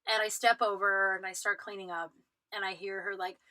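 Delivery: normal talking voice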